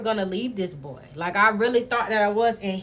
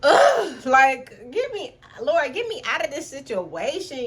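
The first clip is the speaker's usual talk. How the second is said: exasperated plea; 6 semitones higher, 5 dB louder, slower than usual. Retold or imitated. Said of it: "I was just like".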